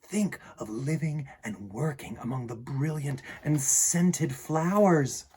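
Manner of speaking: animated read-aloud with lively swings in pitch; children's story narration. A narrator's reading. Reading a picture book about a lion gardener to children, animated storytelling. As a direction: read it to children with playful, varied emphasis.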